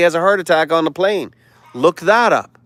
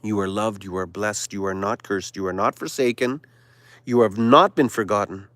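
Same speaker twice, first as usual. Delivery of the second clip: divine reassurance; 6 semitones lower than usual. Acted. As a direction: speak as God comforting his chosen. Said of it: "Your Adonai Elohim says to you".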